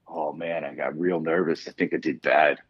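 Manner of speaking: low, soft imitation of a worried friend; a hint of a sheepish, deflated buddy; mock nervous lament. Friend Hex confessing his bad draft, mildly glum and hushed. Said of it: "And he goes".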